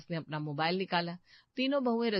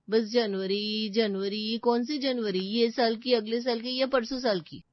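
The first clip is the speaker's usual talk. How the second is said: mocking; 3 semitones higher, 5 dB louder than usual. Mocking mother-in-law's vague promise.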